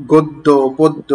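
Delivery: গদ্য, পদ্য with a closed o in the first syllable; correct pronunciation. Shown as a demonstration. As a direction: say গদ্য, পদ্য slowly as "goddo, poddo". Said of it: "উচ্চারণটা কিন্তু গদ্য, পদ্য নয়".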